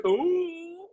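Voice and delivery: shrieking high ooh; high squeal. Real, not acted. Squeal after joke.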